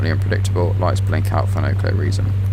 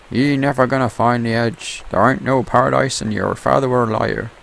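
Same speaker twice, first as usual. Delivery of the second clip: Irish pirate; 6 semitones higher than usual. Acted. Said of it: "I don't know why the pirates ended up Irish".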